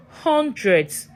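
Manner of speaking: usual speaking voice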